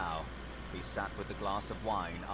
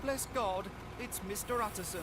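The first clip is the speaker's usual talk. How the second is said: crying out; 12 semitones higher than usual. Acted. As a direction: burst out in relief. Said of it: "crying out".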